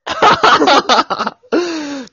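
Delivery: high burst of laughter; loud high laugh. Real, not acted. Laughs at changed resolution.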